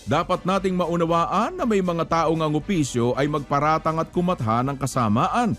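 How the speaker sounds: usual speaking voice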